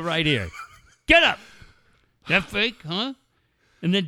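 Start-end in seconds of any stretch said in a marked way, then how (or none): 1.1-1.4 loud high yell; barked command. Retold or imitated. Voicing the wrestler's command. 2.3-3.1 tough guy taunt. Retold or imitated. Wrestler taunting Stossel.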